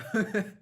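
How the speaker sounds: quick embarrassed laugh; brief burst of laughter; short laugh. Real, not acted. Laughs at own chewing.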